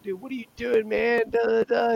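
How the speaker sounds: high-pitched imitation of outrage; mock protesting buddy. Buddy caught with a girl protests, mock outraged. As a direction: imitate a flustered friend, high all through.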